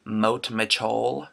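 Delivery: "moat machol", slow and drawn out; brother's childhood "remote control". Retold or imitated. Imitating little brother's speech impediment, slow "moat machol". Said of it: "he had a speech impediment".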